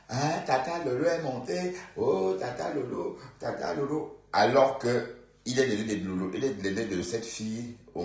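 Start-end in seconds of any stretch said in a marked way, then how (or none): 0.1-4.2 mimicking the morbier. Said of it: "il dit tata Lolo".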